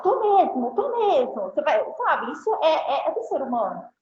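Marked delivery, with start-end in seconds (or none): 0.0-1.4 higher-pitched mock annoyed customer; irritated customer. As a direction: snap back, put out but playful. Você fica mais. (cliente irritado, imitado com ironia)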